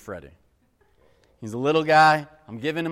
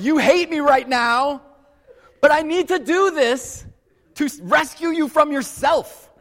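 put-on higher, louder and slow, hamming up his own words to the crying toddler; mock gentle dad voice; 11 semitones higher, 7 dB louder than usual. Father to the sobbing son in the bath, higher, louder, slow and half-laughing. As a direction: play yourself as the patient father, half-laughing. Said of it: "I'm like".

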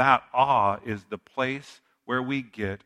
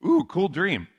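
higher-pitched, mildly delighted ooh; impressed inner thought; 7 semitones higher than usual. Retold or imitated. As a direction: muse aloud as if pleased by a dream. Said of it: "I thought to myself".

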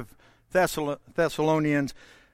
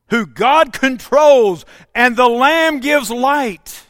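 loud, ringing pulpit voice; fiery preacher in full flow; preaching at full force; 6 semitones higher, 10 dB louder than usual. Acted. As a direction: proclaim it over the congregation.

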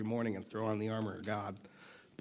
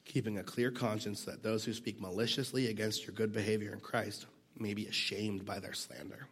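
usual speaking voice

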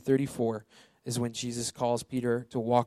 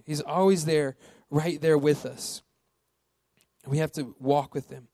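usual speaking voice

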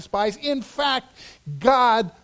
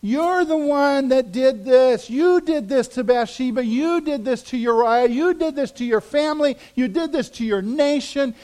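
higher, louder accusing protest; arguing; 5 semitones higher, 4 dB louder than usual. Acted. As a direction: jab each accusing you at him. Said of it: "We might argue with him and go".

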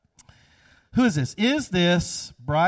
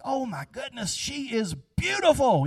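smitten gasp; 7 semitones higher than usual. Retold or imitated. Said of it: "you're like".

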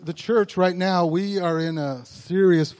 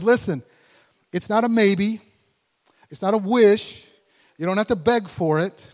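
louder, insistent pulpit voice; emphatic preaching; 4 semitones higher, 4 dB louder than usual. Acted. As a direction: drive each point home. Preaching at growing force.

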